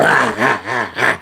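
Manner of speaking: low mocking cackle; mocking laugh. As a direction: laugh down at the teacher. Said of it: "And he had this laugh".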